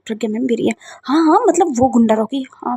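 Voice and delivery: ordinary delivery, nothing marked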